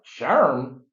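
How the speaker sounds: excited loud retelling; hyped demand. Retold or imitated.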